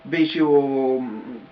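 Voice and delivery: ordinary delivery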